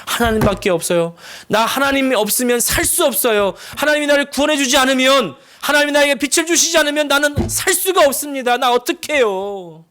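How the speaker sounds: preacher voicing a believer's anguished prayer, high and loud; desperate prayer. Acted desperate prayer in sermon.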